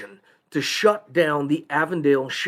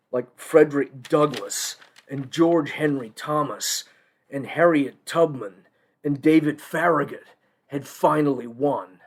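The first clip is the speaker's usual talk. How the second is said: ordinary delivery, nothing marked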